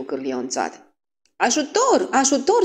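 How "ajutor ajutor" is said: put-on high-pitched girl's cry; play-acted alarm; shouting for help. A narrator's reading. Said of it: "strigă Kitty Mooney".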